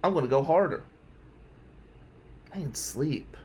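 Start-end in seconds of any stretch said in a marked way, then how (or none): 2.5-3.4 hushed whisper. Real, not acted. Recalling sleepless drive for the job.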